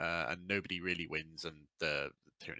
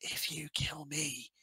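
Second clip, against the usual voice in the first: imitated whisper; 4 semitones higher, 7 dB quieter than usual. Silent King leaning in to Dante before he presses the button, mock whisper. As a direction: lean in softly, as if confiding a secret. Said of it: "leans forward and whispers to him".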